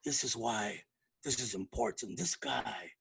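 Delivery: deep, soft, hushed intro voice; low, reverent introduction of a guest. Retold or imitated. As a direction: drop the voice low like a solemn host. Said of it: "he'll lower his tone".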